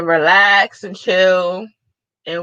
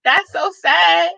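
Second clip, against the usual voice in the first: mama; 8 semitones higher, slower than usual. Retold or imitated. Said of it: "She was like".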